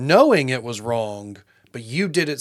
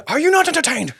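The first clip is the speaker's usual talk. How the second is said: high booming movie quote; Gladiator quote; 12 semitones higher, 5 dB louder than usual. Retold or imitated. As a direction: shout it like an arena hero. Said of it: "Gladiator quote".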